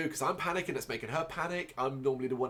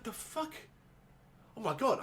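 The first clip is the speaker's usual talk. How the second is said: high, panicked gasp of disbelief; reliving the moment of finding blood, panicked exclamation; 11 semitones higher than usual. Retold or imitated. Owner finds blood in the hallway, high panicked cry. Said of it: "I'm panicking".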